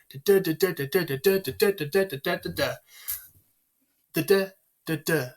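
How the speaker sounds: high, unbroken chant of da DA da DA; iambic pattern. Shown as a demonstration. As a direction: rattle out da, da in even weak-STRONG beats. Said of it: "that's your iambic pattern".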